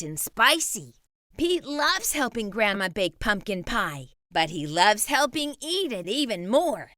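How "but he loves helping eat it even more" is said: ordinary delivery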